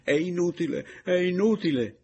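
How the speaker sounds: high, loud, tearful wail; sobbing moan. Acted. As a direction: wail it as if broken by sobs. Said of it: "con la voce rotta dai singhiozzi". (gemendo tra i singhiozzi)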